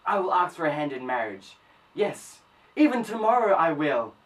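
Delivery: loud, rising determination; fervent resolve. Acted. Man resolves to ask for her hand in marriage, fervent. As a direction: build to a loud, excited declaration.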